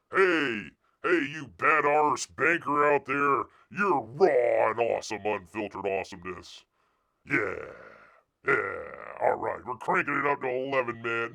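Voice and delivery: mock the hype-man swagger; mock bro talk. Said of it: "do you talk like this".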